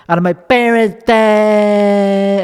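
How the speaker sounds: high, drawn-out cartoon Chicago accent; exaggerated Chicago accent. Comic answering which parent he is closer with, hammed-up Chicago accent. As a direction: ham up the Chicago accent and stretch the last vowel. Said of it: "I'm starting to believe the accent".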